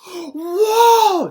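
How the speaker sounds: gasp, then a loud, high whoa; amazed whoa. Retold or imitated. Vlogger recalling a child's amazed whoa. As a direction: explode with childlike wonder.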